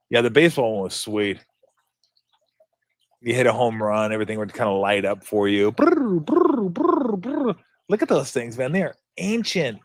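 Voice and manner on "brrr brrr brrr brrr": high rolled brrr like a toy game buzzer; electronic trill imitation. Shown as a demonstration. Telling viewers how the home run lit up, high buzzing trill. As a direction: mimic the handheld's home run celebration noise.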